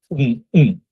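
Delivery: উম, উম as two separate hums; short, firm closed-mouth উম; closed-mouth nasal sound. Shown as a demonstration. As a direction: hum উম twice with lips shut. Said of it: "মুখ বন্ধ করে আমরা উম বলবো".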